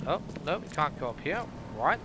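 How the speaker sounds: ordinary talk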